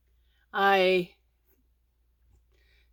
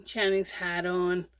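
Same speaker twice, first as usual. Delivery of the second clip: normal talking voice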